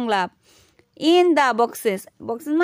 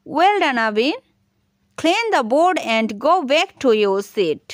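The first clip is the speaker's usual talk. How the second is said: slow, deliberate classroom command; slow model reading; much slower than usual. Shown as a demonstration. Teacher voicing a command to Abir, slow. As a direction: spell the command out slowly. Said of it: "তাহলে এটি এভাবে বলতে পারি".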